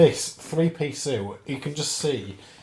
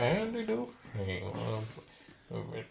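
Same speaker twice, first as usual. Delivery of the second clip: Morgan Freeman impression; 3 semitones lower, 5 dB quieter than usual. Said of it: "You do a Morgan Freeman impression?".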